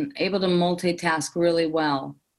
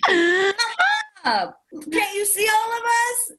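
retold yell. Impatient customer yelling at staff behind the counter, high mock yell. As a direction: shout it up at the staff, half laughing at herself. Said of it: "literally yelled in the middle of Boston Market".

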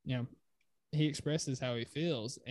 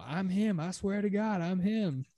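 high, louder imitation of a boast; hyped trash talk; 7 semitones higher, 5 dB louder than usual. Podcaster imitates Shai bragging to teammates, high hyped boast. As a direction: imitate a fired-up player hyping himself. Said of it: "he's very hype".

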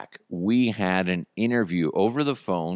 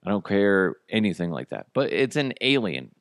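usual speaking voice

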